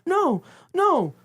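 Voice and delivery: high, fast mock anger, hurried and pushy; imitating a panicked angry hush-up, pitched high; acting out a friend shooing people away, angry and high; mad. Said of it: "Liz gets mad".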